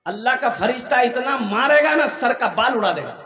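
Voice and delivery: ordinary talk, nothing marked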